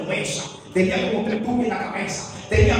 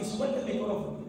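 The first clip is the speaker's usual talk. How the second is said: softer inner voice urging him; an inner prompting, said softer; 11 dB quieter than usual. Retold or imitated. Preacher recalls the inner voice, said softer. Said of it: "algo me decía dentro de mí".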